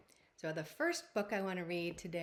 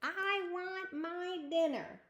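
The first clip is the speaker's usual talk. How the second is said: high, slow and sassy demand; 10 semitones higher, slower than usual. Shown as a demonstration. Reading the little princess demanding dinner, sassy and high. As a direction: whine it out like a spoiled girl. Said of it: "She sounds kind of sassy".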